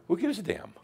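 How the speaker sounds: laughing mock nonchalance; carefree master. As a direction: toss it off like a breezy master. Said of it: "And the master".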